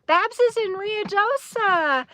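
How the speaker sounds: high, drawn-out excited announcement. Real, not acted. Streamer reads where a friend is camping, excited. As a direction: burst out with excited news, stretching it. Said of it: "I got so excited".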